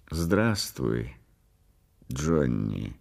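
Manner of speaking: low, lingering greeting; soft-spoken sinister caller; creepy fat visitor. A narrator's reading. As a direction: greet him slowly, sinking low on the name. Menacing fat visitor greets the patient.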